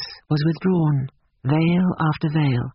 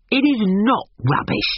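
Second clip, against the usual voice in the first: heated contradiction, raised pitch; hotly; 7 semitones higher than usual. A narrator's reading. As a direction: contradict with a touch of heat. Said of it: "said the old man hotly".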